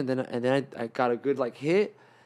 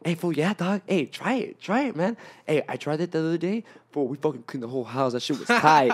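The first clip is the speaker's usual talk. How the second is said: hyped-up roommate; 3 semitones higher than usual. Retold or imitated. Hyped roommate urging his tired friend to try meth, eager. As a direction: sell it like it's the best thing ever. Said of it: "he's all like".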